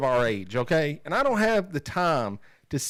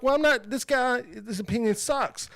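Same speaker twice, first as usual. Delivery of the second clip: dismissive; 9 semitones higher than usual. Retold or imitated. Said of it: "you're just like".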